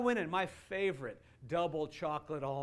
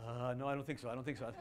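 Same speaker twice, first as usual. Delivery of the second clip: reluctant; 6 semitones lower, 5 dB quieter, faster than usual. Retold or imitated. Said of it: "That's reluctantly or under compulsion".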